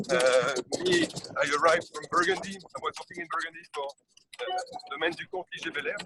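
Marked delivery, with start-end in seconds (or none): 2.6-6.0 talking while bouncing over bumps; on a bumpy road. Real, not acted. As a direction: voice jolted by a bumpy ride. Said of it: "Sorry, it's a bit, uh, bumpy".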